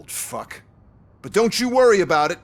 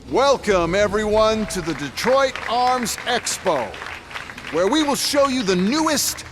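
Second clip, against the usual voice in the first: showman presentation; 4 semitones higher than usual. Acted. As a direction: sell the robots like a stage host. CEO presents robots at arms expo.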